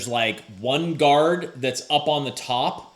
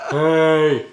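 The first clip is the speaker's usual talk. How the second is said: shout; 6 dB louder than usual. Retold or imitated. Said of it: "you're like".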